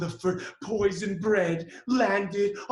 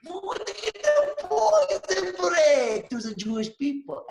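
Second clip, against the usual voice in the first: gloating villain; 11 semitones higher, 6 dB louder than usual. Acted. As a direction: savour the evil plan like a pantomime baddie. Haman gloats over poison plan.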